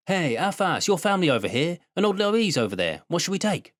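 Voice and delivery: put-on high, eager boy's call; boy calling from the bank. A narrator's reading. Shang-Chi calling friends from the riverbank, high and eager. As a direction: call back to the boat as a lively village boy. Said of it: "was calling at us back from the bank".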